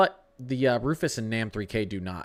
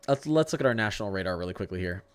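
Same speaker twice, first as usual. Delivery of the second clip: normal talking voice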